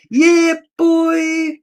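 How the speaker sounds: high, drawn-out holler; hype shout. Acted. Host hyping up a viewer who just arrived, hype shout. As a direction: stretch both words out loud and high.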